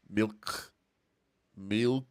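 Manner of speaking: "Milk" with a heavy K, as if spitting. Shown as a demonstration. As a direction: end "Milk" on a hard, spat-out K. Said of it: "milk with a heavy K as".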